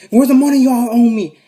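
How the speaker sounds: robber's demand. Acted. Robber demands money, retold.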